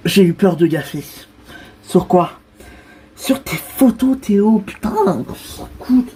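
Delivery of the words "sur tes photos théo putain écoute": exasperated father hissing at his son; irritated scold. Acted. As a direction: tell him off through your teeth. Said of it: "Quand t'es énervé, tu m'appelles Théo".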